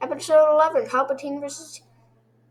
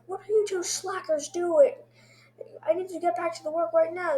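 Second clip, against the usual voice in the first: lightly voiced higher-pitched boss telling off slackers; mock scolding boss; 7 semitones higher than usual. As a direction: imitate a boss scolding his workers. Voicing Benson scolding the slackers.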